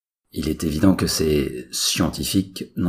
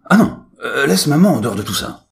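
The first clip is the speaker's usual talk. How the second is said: whiny protest; 12 semitones higher, 9 dB louder than usual. Acted. Son protests about his mother.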